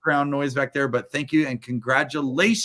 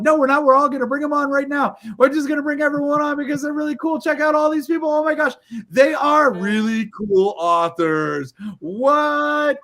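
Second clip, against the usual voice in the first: much higher and louder, bubbling hype; hyped host; 11 semitones higher, 4 dB louder than usual. Real, not acted. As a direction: pump up the crowd about the authors. Host hyping all the authors.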